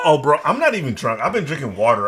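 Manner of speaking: ordinary talk, nothing marked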